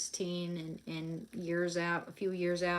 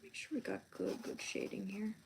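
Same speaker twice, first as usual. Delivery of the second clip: whisper to self; 8 dB quieter than usual. Real, not acted. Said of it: "drawing, muttering to self".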